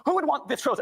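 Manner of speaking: imitating the pastor's climax; preacher's crescendo. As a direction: build to the big sermon moment. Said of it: "his big crescendo".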